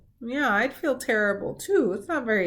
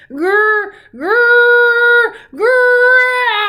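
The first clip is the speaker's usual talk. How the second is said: very high, loud sung growl; 13 dB louder, much slower than usual. A narrator's reading. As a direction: let the growl blast out. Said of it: "growling got louder and louder".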